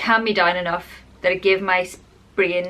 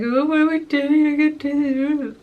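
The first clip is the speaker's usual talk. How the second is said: tearful despair; 7 semitones higher than usual. Retold or imitated. Retelling crying to her husband.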